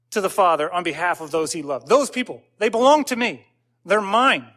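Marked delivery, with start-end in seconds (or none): 1.9-4.5 pleading. Acted. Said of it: "intercedes and pleads".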